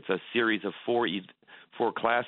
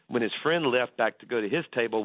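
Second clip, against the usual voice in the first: normal talking voice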